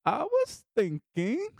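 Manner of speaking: slow rising "I was thinking"; daughter's coy line. Retold or imitated. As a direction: drag it out sweetly like a daughter angling for cash.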